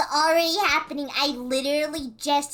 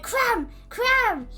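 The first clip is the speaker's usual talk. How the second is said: higher-pitched imitation of someone calling out; mock loud announcing; 7 semitones higher than usual. Stranger announcing the streamer to everyone, mock calling out. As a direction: call the name like announcing it to a room. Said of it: "just loudly announced".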